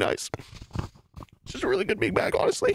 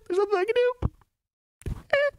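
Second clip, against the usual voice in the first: mock despair; slower than usual.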